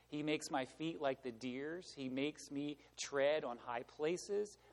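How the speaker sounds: soft, low, measured recitation; scripture reading. Acted. Preacher reads Habakkuk's closing psalm, hushed and reverent. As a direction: read it as quiet, reverent trust.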